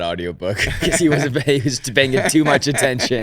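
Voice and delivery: high, loud, laughing tease; joking through laughter. Real, not acted. Co-host ribbing her about the audiobook engineer, laughing. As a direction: tease loudly, laughing as you go.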